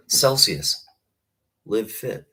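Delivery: joking sponsor plug with brand slogan; mock ad read. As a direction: pitch the product like a playful commercial. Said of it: "we could do a little ad spot".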